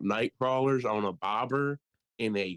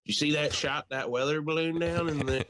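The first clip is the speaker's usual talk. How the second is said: higher-pitched mock country-club accent; mock rural accent; 4 semitones higher than usual. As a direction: imitate locals with a put-on rural accent. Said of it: "why they have that accent".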